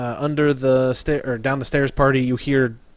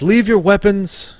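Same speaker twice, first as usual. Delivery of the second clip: slightly raised, louder villager voice, lightly put on; a tentative character voice, a bit higher; first-try mystery voice; 5 semitones higher, 3 dB louder than usual. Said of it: "that's not a good voice".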